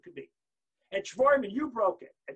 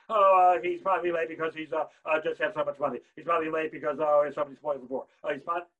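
loud, lower, stammered mock babble; self-deluding excuses; 4 semitones lower, 8 dB louder than usual. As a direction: run on with blustering excuses. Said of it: "So she fools herself".

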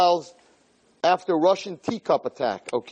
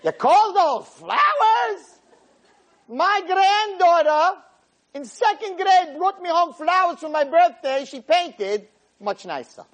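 put-on old lady; 14 semitones higher, slower than usual. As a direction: squawk it out like a scornful granny. Old lady quoted again.

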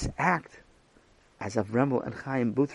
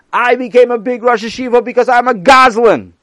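mock speech; 10 semitones higher, 12 dB louder than usual. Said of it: "you're gonna say".

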